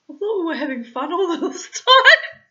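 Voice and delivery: 2 very high, loud laughing whine; 1 laughing protest. Retold or imitated. 4 husband laughing off her complaint, high loud whine.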